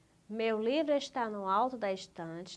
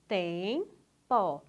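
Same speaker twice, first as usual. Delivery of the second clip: "Tempo" split into two syllables with a clear gap; 4 dB louder than usual. Shown as a demonstration. Teacher showing pupil how to separate syllables, syllable by syllable. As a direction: break "Tempo" in two, lifting the first syllable and dropping the second. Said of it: "Separa aí".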